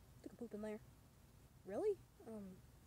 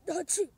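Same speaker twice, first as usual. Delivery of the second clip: fake sneeze; 3 semitones higher, 6 dB louder than usual. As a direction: sneeze cutting off the sentence. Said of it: "I can't even".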